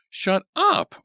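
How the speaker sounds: high, loud snapped order; high loud furious snap; angry snap. A narrator's reading. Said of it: "Artie snapped".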